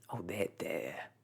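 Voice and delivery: a hint of gruff bird comforting; lightly voiced gruff starling. A narrator's reading. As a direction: soothe grudgingly and gruffly. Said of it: "said the starling gruffly".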